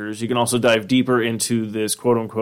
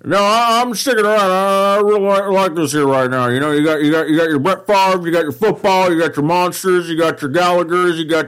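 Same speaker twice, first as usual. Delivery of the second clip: hyped football announcer rattling off a list; John Madden impression; 6 semitones higher than usual. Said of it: "John Madden got out the door".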